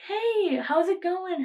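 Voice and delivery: high, chirpy mock-friendly hello; bubbly friendly greeting. Imitating a classmate's sudden friendly message, chirpy and high. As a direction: pitch it up like a sugary sweet text.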